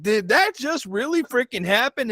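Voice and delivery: stunned. Retold or imitated. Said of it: "I freaked out".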